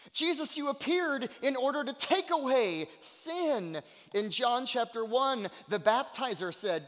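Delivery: high, loud, impassioned sermon voice; ringing preacher's cadence; forceful preaching. Real, not acted. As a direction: proclaim the scripture with fervour.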